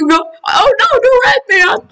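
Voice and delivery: high, wailing mock alarm; mock panic. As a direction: cry out in hammed-up distress. Teammate choking in the game.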